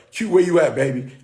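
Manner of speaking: loud call to someone off mic. Real, not acted. Calling co-host Q to join.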